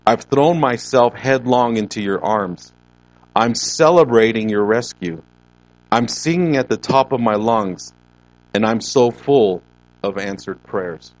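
loud, emphatic verse reading; loud scripture proclamation. Acted. Preacher voicing a psalm to the congregation, loud and declamatory. Reads verse out at full force.

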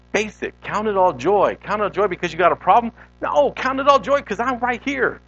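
high, urgent exhortation in God's words; emphatic preaching. Acted. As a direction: build to an insistent preached call. Preacher voices God urging the congregation.

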